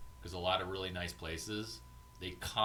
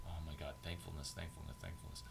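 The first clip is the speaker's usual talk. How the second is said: murmured mockery; 4 semitones lower, 10 dB quieter, faster than usual. Real, not acted. Mocking repetition of a phrase.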